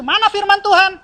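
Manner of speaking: high, loud mocking question; the devil scoffing at the believer; devil's challenge. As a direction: taunt like the devil. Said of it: "iblis tuh pasti bilang itu".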